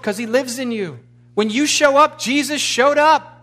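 rally the church, voice ringing; fervent preaching climax. Acted. Preacher rousing the congregation as God's answer, loud and high. Sermon climax at full force.

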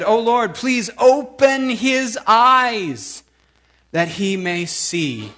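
loud, high, drawn-out plea to the Lord; fervent prayer. Acted. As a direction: cry out to God, stretching the words. Elisha prays aloud.

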